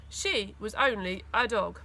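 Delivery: plain reading voice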